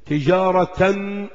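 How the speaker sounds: تجارة read slowly as tijaratan; slow demo of تجارة ending in -tan; tanween with fathatayn. Shown as a demonstration. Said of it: "فتحتين".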